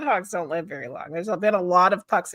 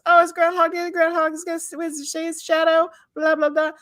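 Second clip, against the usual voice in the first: mocking; 7 semitones higher than usual. Mocking the usual Groundhog Day line.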